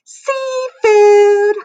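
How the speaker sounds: put-on very high, loud kid squeal. A narrator's reading. Kid showing off chewed lunch, squealing. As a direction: squeal it out, drawn out and piercingly high. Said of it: "she would squeal".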